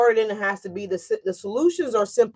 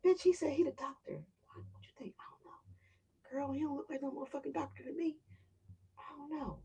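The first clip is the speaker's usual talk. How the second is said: put-on hushed whispered gossip between girlfriends; gossipy whisper; 4 semitones higher, 17 dB quieter than usual. Two friends doubting the doctor, whispered gossip. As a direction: whisper it like talking behind his back.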